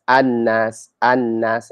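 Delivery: annas with a doubled n; annas, no l heard; assimilated annas. Shown as a demonstration. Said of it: "we don't say alnas".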